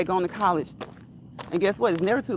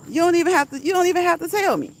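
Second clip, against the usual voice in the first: high, loud laughing tease; excited teasing; 8 semitones higher, 4 dB louder, faster than usual. Real, not acted. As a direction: rib a friend with delight. Teasing a friend on call.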